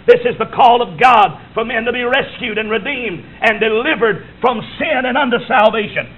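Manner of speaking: ordinary talk, nothing marked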